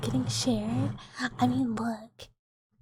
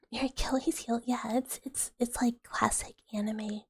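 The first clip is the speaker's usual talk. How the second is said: ordinary delivery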